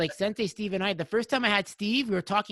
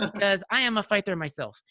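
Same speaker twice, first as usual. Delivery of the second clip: badly imitated Russian accent; heavy Russian accent; 3 semitones higher than usual. Imitating a fighter friend correcting him, heavy Russian accent. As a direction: put on a thick, butchered Russian accent. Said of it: "I'm gonna butcher the accent".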